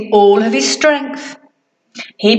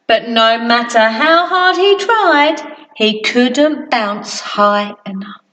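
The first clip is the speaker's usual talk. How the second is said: ordinary delivery, nothing marked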